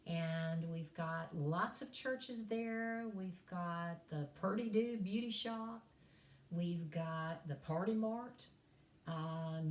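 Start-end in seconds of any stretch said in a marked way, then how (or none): 0.1-8.5 slow, thick East Tennessee drawl; East Tennessee accent. Shown as a demonstration. Professor showing off hometown talk, East Tennessee drawl. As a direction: stretch the vowels in hometown Tennessee twang. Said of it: "that's how we talk in East Ridge".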